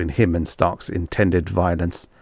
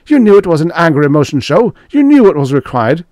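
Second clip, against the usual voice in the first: high, loud, fast accusation; angry cry; 10 semitones higher, 9 dB louder, faster than usual. A narrator's reading. Stark accusing Grayson over the show, furiously. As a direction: hurl the blame at him in a rush. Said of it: "he cried".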